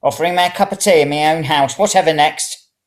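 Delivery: put-on high, loud indignant old lady; indignant snort. A narrator's reading. Old woman scoffs at being offered tea, high and indignant. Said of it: "The old woman snorted".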